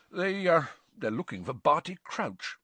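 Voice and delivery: hesitant, halting start; stall, then get the answer out; nervous. A narrator's reading. Bagman explains the goblins to Harry, mildly nervous. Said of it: "looking suddenly nervous".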